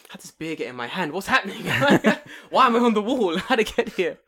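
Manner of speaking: high, loud, breaking into laughs; baffled, laughing at himself; laughing confused self-quote. Retold or imitated. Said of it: "I'm like".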